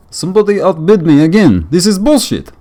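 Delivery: loud, high mock Russian voice; Russian accent. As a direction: play a grumpy Russian bidder, shouting. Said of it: "I don't know if I can do a Russian accent".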